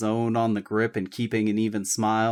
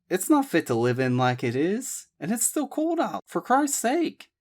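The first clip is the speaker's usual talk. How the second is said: Abby protesting; 9 semitones higher than usual. A narrator's reading. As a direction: push back, exasperated.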